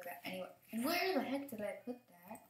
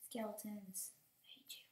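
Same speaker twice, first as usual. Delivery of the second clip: ordinary talk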